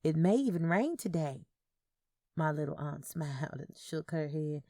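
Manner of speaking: ordinary delivery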